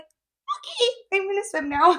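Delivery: very high, small mock voice; imitated flustered high pitch; retold awkward squeak. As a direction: squeak out a nervous excuse. Retelling her nervous escape.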